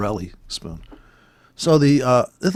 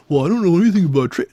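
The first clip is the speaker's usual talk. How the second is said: mocking timid beginner; 4 semitones higher, 5 dB louder, much faster than usual. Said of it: "You gotta spend four grand".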